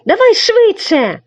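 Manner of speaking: high urgent loud push; hurrying. Acted. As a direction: hurry him along, loud. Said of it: "квапив Марусик". (нетерплячий високий поспіх)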